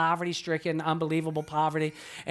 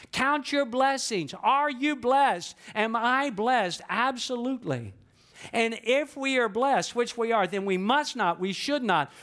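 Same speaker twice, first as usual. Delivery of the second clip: higher, slow, emphatic preaching climax; rising preaching; 5 semitones higher, slower than usual. Acted. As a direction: build the sermon to a peak, slow and strong.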